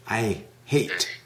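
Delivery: hate with a long ay, said softly; model word hate. Shown as a demonstration. Teacher models hate for the student, softly. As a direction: lay out hate clearly for the student. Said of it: "you know the word hate".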